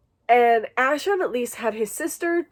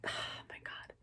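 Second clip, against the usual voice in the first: breathless whisper to herself; whispered oh my God; 23 dB quieter, faster than usual. Real, not acted. Reader overwhelmed by the book's dedication, whispering. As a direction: let it slip out as a hushed whisper.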